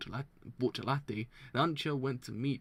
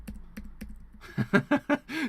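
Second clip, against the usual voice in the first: excited laugh; 5 dB louder than usual. Real, not acted. Laughs at own hype.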